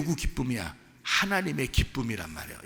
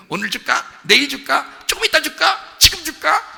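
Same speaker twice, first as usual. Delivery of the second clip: building higher and louder with each question; playful teasing father; 12 semitones higher, 7 dB louder than usual. Acted. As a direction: tease the child with rising excitement. Father teasingly offers gift to child. (점점 높고 크게 약 올리는 아빠 흉내)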